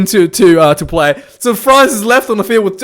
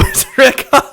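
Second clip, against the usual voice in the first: laughing while imitating the official; mock verdict, cracking up; laughing through it; 4 semitones higher than usual. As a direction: break into a laugh on the words.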